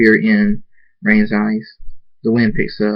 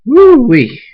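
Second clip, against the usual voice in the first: excited whoop; 9 dB louder than usual. Real, not acted. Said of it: "Three is a lot longer".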